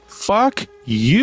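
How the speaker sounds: angry retort. Real, not acted. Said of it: "Oh, okay".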